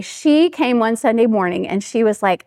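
ordinary talk, nothing marked

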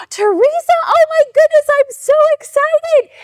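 very high, hammed-up excitement; overjoyed squeal. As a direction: squeal at an unexpected gift, playing it big. Acts out her delighted reaction.

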